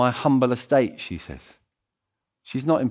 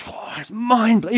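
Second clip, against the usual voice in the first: gushing fan; 11 semitones higher, 3 dB louder than usual. Retold or imitated. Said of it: "Everyone is like".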